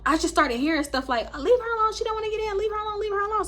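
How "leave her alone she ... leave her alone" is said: much higher-pitched mimicked voice; imitated bystander protest. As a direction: call it out high, as an onlooker.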